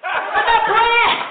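loud, high burst; shouted punchline. Real, not acted. Comic answering the audience, shouting the punchline. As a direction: blurt the punchline out.

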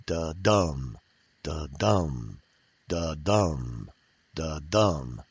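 "da-dum" low, with the weight on DUM; heartbeat stress pattern. Shown as a demonstration. Poetry teacher demonstrating an iamb, "da-dum" as a low heartbeat. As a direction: lean on the second syllable of each "da-dum", like a heartbeat. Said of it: "Think of a heartbeat. It goes".

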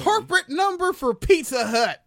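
high, loud mock Karen command; imitating Karen's voice search. Mocking the customer Googling by voice, high loud imitation. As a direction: bark it at the phone like her. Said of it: "she's Googling by voice".

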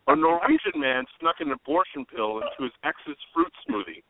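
normal talking voice

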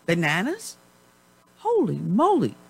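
mock outrage at grocery prices; shocked disbelief. Real, not acted. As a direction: react to the price like it's robbery.

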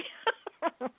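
short, high-pitched laugh; real laugh. Real, not acted. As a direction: laugh briefly mid-sentence. Laughs at own phrase mid-sentence.

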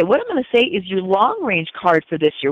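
ordinary delivery

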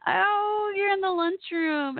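high, drawn-out "oh" of discovery; surprised realization. Real, not acted. Host works out the soldiers are in the lunchroom, delighted surprise. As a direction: let it dawn on you, voice lifted high. Suddenly understands where the listeners are.

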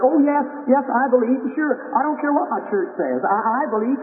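put-on high, eager young girl's voice. Sick girl tells preacher she believes, eager and high. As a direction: pitch it up like a hopeful sick girl.

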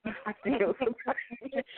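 chuckling through the words; real laughter on a dark joke; laughing. Real, not acted. Laughing while joking about killing.

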